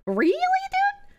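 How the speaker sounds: high, slow, drawn-out disbelief; incredulous. Real, not acted. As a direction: stretch it out in disbelief. Incredulous reaction to chat.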